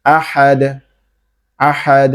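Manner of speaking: আহাদ with a bounced final দ; vibrating extra release on the end of আহাদ; echoing qalqala. Shown as a demonstration. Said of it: "দুইবার উচ্চারণ করতেছেন".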